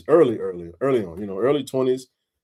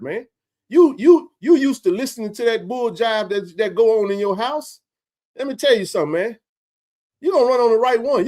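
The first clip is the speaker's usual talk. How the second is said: high, heated scolding; retold angry lecture; 8 semitones higher than usual. Scolding the boy about the word, heated retold lecture. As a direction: lecture the kid hard. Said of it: "I was hot".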